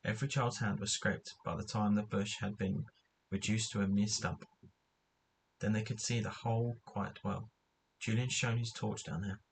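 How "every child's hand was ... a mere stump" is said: usual speaking voice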